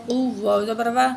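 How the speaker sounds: ordinary delivery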